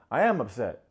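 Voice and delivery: loud imitation of being upset; acted loud exasperation; mock angry insistence. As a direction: snap it back, hammed-up.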